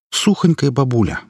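plain reading voice

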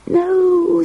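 high squealed protest; child's squeal. A narrator's reading. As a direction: squeal it like a little girl. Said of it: "squealed Elizabeth".

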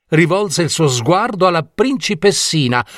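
usual speaking voice

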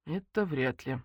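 soft, resigned doubt; subdued and doubtful; sighing. A narrator's reading. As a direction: let it out gently, like a sigh. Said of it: "вздохнула я".

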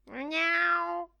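high, loud cat mew; loud meow. Acted. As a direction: demand it like a hungry cat. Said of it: "disse forte la gatta". (la gatta miagola forte verso Bloom)

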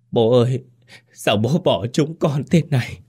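high, wailing lament; choked grief. A narrator's reading. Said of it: "Anh Phú nghẹn ngào". (nghẹn ngào than khóc bố)

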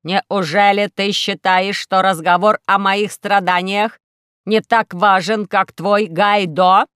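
loud, slow hurt robot voice; an aggrieved robot pressing his point; slow, loud indignant robot; offended robot. Acted.

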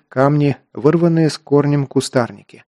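usual speaking voice

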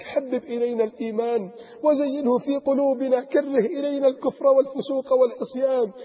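ordinary delivery

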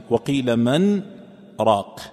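"وقيل من" stressed, then a stop before "راق"; the nun of "من" held clearly, then "راق"; clear nun with pause. Shown as a demonstration. Sheikh shows the separated reading to students. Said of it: "إن قرأت بالإظهار".

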